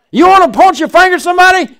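loud, high preacher's scolding; preaching rebuke at full force; fiery rebuke. Real, not acted. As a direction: point the finger back at them.

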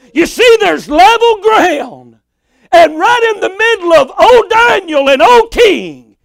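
high, ringing preacher's delivery; full-force preaching. Real, not acted. Preacher declaring level ground to the congregation, full-force preaching. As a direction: lift it and drive each phrase home.

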